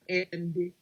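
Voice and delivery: ordinary talk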